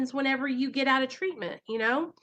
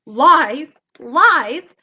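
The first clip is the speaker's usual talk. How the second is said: a loud, forceful double "lies"; loud denial; 4 semitones higher, 14 dB louder than usual. Real, not acted. Calling out her addiction's lies to viewers, loud. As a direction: throw out "lies" hard and loud.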